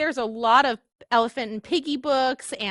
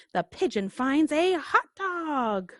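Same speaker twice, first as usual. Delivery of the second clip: usual speaking voice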